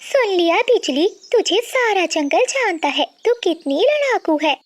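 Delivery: high-pitched gloating neighbour; high, feisty bird character; quarrelsome bird, taunting. Acted. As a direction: rub it in with a high voice. Voices Priya taunting Bijli.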